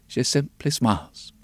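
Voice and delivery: usual speaking voice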